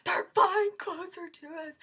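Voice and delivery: high, loud fan exclamation; retold excited cry. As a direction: cry out as the stars approach. Retold excitement as band approaches.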